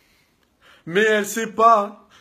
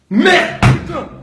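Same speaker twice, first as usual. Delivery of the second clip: ordinary delivery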